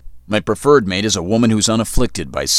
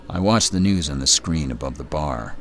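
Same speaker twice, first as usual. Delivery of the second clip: ordinary delivery, nothing marked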